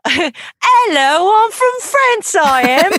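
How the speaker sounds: put-on high, loud joke French accent; mock French accent. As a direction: ham up a silly French accent. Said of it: "Practice accents".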